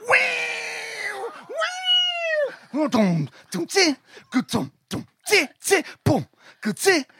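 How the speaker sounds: high wailing guitar, then drum hits; imitated guitar and drums. Retelling guitarist playing the cover, vocal instrument noises. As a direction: mouth the riff with wild pitch leaps. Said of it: "your man was like going".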